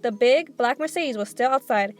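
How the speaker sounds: ordinary delivery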